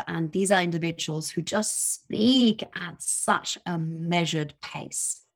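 ordinary delivery

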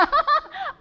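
short, high, loud laugh; real amused laugh; bright laugh. Real, not acted. Doctor laughing about God protecting her, bright laugh.

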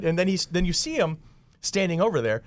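ordinary talk, nothing marked

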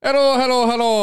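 high, put-on Irish greeting; Irish accent. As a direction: ham up an Irish hello. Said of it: "I forgot about the accents".

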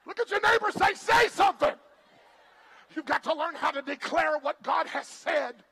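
loud preacher call to the crowd; preaching at full force. Acted. Preacher urging congregation to declare God's word, shouting. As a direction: fire them up to declare.

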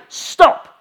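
sudden high, loud order; one explosive word of command; commanding shout. Acted. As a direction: shout it to halt a storm. Jesus commanding the storm, acted.

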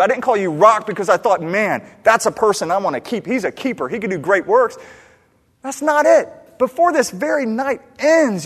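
shouted, high-pitched scolding; exasperated rebuke. Acted. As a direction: tear into him loudly, as if he were deluded. Voices Jesus rebuking Peter.

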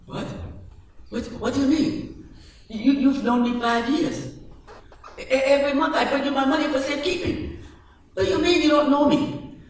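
high, rising shocked protest; shocked disbelief. Acted. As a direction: voice climbing in disbelief.

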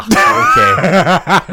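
high, loud laughing okay; laughing shout. Real, not acted. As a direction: burst out laughing on it.

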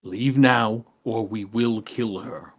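voiced threat, firm and unhissed; plainly spoken, deliberate ultimatum; repeated threat. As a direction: repeat the warning in full voice. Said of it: "just repeats essentially what Corbinian says".

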